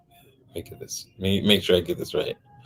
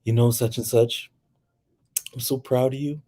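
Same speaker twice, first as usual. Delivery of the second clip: tender praise; 5 semitones higher than usual. Retold or imitated. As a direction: speak tenderly to a child actor. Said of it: "he's like".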